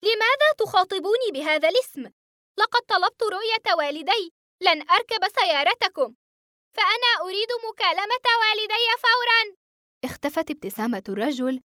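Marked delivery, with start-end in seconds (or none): 0.0-9.5 shouting demand. A narrator's reading. Boy refusing the car, demanding his father, shouting. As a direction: yell it at him, refusing to get in. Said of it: "صرخت متسائلًا".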